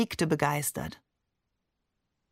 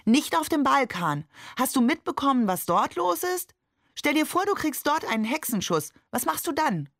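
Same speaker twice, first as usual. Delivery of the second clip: high-pitched, pressing questions; agitated protest; 7 semitones higher than usual. A narrator's reading. Wife arguing against the drive through the Balkans, agitated. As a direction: argue it urgently, voice pitched up.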